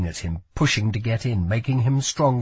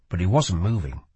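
ordinary delivery

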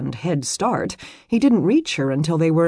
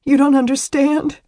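high, louder tearful protest; sobbing; 10 semitones higher, 7 dB louder than usual. A narrator's reading. Distraught woman crying into the man's chest, sobbing. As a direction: wail it through acted sobs. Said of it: "she sobbed into his chest".

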